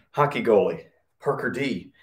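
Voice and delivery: ordinary delivery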